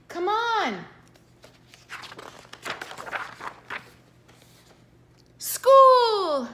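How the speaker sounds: high, loud, eager shout of "School!"; a little girl bursting to get to school; excited child shout. Acted.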